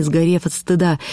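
ordinary delivery

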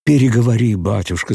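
громкий, высокий выкрик; крикни из толпы. A narrator's reading. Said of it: "закричали ему из толпы". (толпа кричит)

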